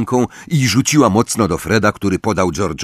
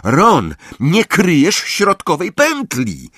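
high, drawn-out scolding call; shouted reprimand; 9 semitones higher, slower than usual. A narrator's reading. Angelina telling Ron off, shouting high. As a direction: yell the fault across the pitch. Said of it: "krzyknęła Angelina".

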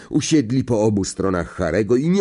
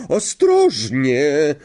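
high, long-stretched warning cry; drawn-out call; 6 semitones higher, 4 dB louder, much slower than usual. A narrator's reading. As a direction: call it out slowly, stretching the word. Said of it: "zawołała profesor Sprout". (przeciągłe wołanie)

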